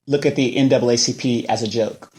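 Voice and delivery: ordinary delivery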